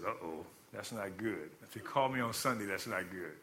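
low, hushed worry to oneself; understated, sinking aside; retold foreboding. Retold dread at a Sunday call.

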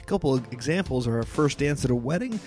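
upbeat sponsor read; chipper 1950s ad. Acted. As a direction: sell it like a cheery radio spot. Host reads sponsor ads.

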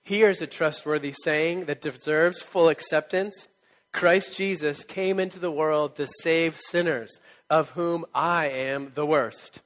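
slow, loud, solemn reading; scripture reading. Acted. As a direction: read it out like scripture to a church. Reads scripture aloud to the church.

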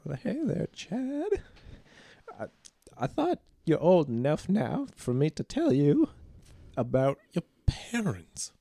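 put-on high sweet old granny voice; grandma voice. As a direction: talk like a kindly grandmother. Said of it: "and I'm his grandmother".

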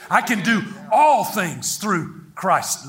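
"all" drawn out and stressed, slowly. Shown as a demonstration. Preacher returning to the verse, "all" long and emphatic. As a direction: stretch the word "all" with force. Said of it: "let's emphasize this word".

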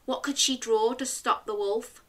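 usual speaking voice

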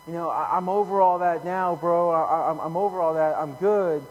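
loud, insistent mock denial; acted insistence. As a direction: protest too much, imitating a man who claims he's fine. Acts out man denying his regrets.

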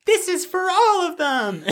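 host voicing Sarah for laughs; mock Sarah line. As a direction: ham up a high, drawn-out heroic line. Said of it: "Sarah ... coming in and be like".

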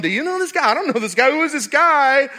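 high, loud, bewildered asking; baffled whisper-free outburst. Retold or imitated. Mary and Joseph puzzled over a stranger holding their baby, high and loud. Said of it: "Mary and Joseph are looking at each other going".

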